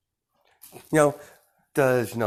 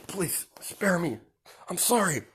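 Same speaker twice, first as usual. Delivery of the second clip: high, breathy begging for mercy; whispered pleading; 10 semitones higher than usual. Acted. Dying leader begging Deku to spare him, in a weak whisper. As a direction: beg weakly for your life.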